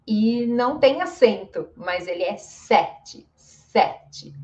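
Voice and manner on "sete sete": "sete" with stressed open eh. Shown as a demonstration. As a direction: say "sete" as SEH-chee, word by word.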